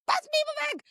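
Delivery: very high, strained imitation of impatience; mock screech at a dithering friend; exasperated mock screech. Retold exasperated repeated demand.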